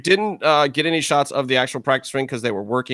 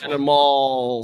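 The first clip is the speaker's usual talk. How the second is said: drawn-out echo; much slower than usual. Retold or imitated. Echoes the drawn-out word mockingly.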